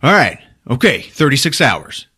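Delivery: forceful retold order; acted tough demand. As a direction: lay down the law loudly, mock-tough. Acted threatening demand in film retelling.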